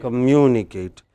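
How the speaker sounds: "Communicate" with a strong MU; stress on MU. Shown as a demonstration. Teacher confirming the class's answer, stress on MU. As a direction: stress the second syllable of "Communicate". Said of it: "two syllables before A T E".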